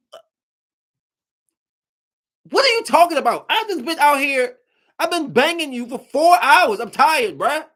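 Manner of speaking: high, loud incredulous protest; exasperated retort. Retold or imitated. Telling the girlfriend he is tired, high, loud and exasperated. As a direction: push back in disbelief.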